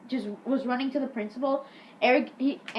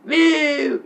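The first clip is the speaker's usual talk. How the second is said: loud drawn-out boo; hammed-up booing; mock jeer; 3 semitones higher, 8 dB louder than usual. [name] defies the teacher, loud mock boo.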